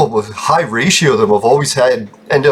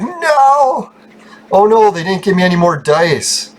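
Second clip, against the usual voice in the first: sudden high panicked cry of no; 7 semitones higher, slower than usual. Real, not acted. Gamer shocked that the dice ran out, high panicked cry. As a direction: yelp in shock at losing the dice.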